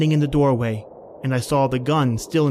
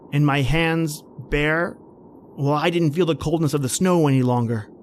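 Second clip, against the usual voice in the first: ordinary talk, nothing marked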